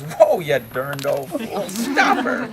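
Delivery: loud, drawn-out holler climbing high at the end; hammed-up alarmed shout, stretched out; Pa's yell. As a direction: yell to halt a kicking mule. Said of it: "Pa yells".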